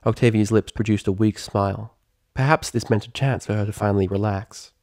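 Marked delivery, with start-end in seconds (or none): none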